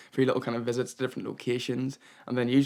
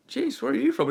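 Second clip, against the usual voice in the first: very high, amazed voice; acted-out astonishment; astonished question; 13 semitones higher, 3 dB louder than usual. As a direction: blurt it out in disbelief. Acts out asking the stranger.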